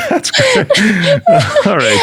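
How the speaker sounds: high, breathless laughter over the words; laughing hard. Real, not acted. Host cracking up at the guest's joke, laughing. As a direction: crack up and barely get the words out.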